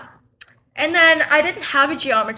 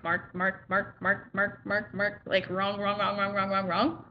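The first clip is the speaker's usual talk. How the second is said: low, soft rapid marking mutter; quiet mutter; 5 semitones lower, 8 dB quieter than usual. Retold or imitated. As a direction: mutter it under your breath. Imitating teacher marking test.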